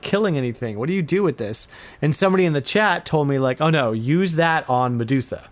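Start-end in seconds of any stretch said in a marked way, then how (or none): none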